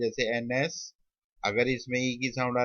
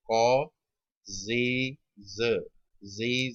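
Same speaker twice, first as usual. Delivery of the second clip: slow sounding-out; much slower than usual. Shown as a demonstration. Teacher breaking a word into sounds for students, slow sounding-out. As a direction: separate sounds with gaps between. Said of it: "slow पढ़ के देखो तो sound".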